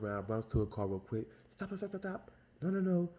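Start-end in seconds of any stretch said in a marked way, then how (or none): 1.5-3.1 high, urgent calls to stop; hurrying to wave the car off; frantic stop. Retold or imitated. Old guy running to her car, urgently calling stop.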